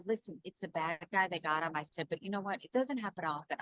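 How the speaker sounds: put-on soft, low-key voice to a child; calm a child quietly, as retold; reassuring parent. Mother reassuring her young son.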